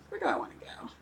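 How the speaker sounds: soft unvoiced whisper to herself. Real, not acted. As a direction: breathe it out under your breath.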